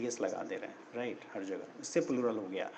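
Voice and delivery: usual speaking voice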